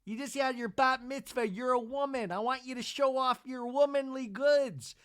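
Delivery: mock-leering husband on a FaceTime call; imitating Seth. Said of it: "waiting for Seth to pop up".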